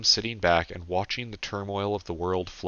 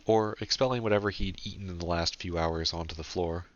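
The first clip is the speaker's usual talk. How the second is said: plain reading voice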